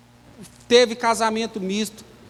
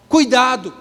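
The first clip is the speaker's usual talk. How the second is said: warning; 5 dB louder than usual. Acted. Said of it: "ele fala assim, ó".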